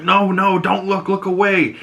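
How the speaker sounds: retold urgent plea, pitched high; urgent shielding. Carmine to daughter at mother's death, urgent shielding. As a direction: imitate a frantic father turning a child away.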